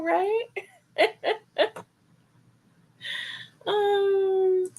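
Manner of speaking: high, breaking giggle; laughing. Real, not acted. Answering a chat friend, giggling. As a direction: let the laugh take over.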